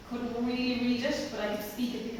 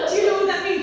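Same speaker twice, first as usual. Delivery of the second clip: mock exasperated headmaster; 11 semitones higher, 12 dB louder, faster than usual. Headmaster quizzing her, retold.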